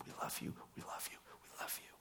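fast hushed whisper of parents' love. Retold or imitated. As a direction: murmur it to a hugged child. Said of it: "told him".

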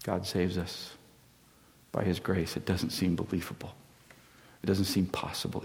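soft, near-whispered preaching; hushed closing preaching. Acted. Preacher closes the sermon before communion, hushed and intimate. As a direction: draw them in with a hushed voice.

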